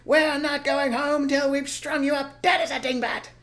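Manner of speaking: high, loud threatening farmer; loud high menace; shouting threat. Acted. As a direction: threaten the fox down the hole. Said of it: "shouting into the hole".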